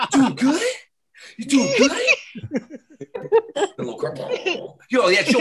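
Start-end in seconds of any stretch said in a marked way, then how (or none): 0.1-1.9 higher, friendly put-on check-in; a hint of chummy Wahlberg; Wahlberg greeting. Wahlberg's Batman greeting someone, lightly voiced. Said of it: "Mark Wahlberg".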